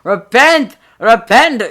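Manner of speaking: high loud ranting yell; old man yelling. Acted. Retake: old man shouting at the teens, loud rant. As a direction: bellow like a street prophet. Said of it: "the guy starts yelling at us".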